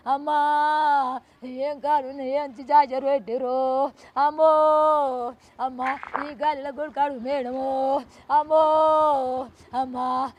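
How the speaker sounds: slow, drawn-out keening for her son; wailing lament. Real, not acted. Mother mourning her son to the reporter, slow wailing lament. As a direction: mourn aloud, stretching every cry of అమ్మా.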